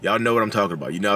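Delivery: ordinary talk, nothing marked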